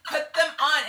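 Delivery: loud high scream. Retold or imitated. Ordering a stripped man to dress, loud scream. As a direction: scream it at him. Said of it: "I was screaming at him".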